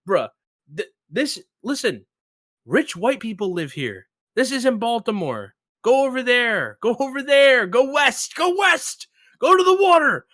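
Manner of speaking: a hammed-up retold rant at a clueless friend; exasperated mock yelling, pitching up and up; frantic rant. Retold ranting at friend's guesses.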